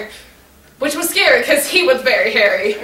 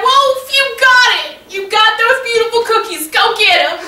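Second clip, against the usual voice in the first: encouraging yell; 7 semitones higher than usual. Retold or imitated. Neighbor urging despondent Wolf onward, high encouraging yell. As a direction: call out high to spur him on. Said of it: "And I yelled at him".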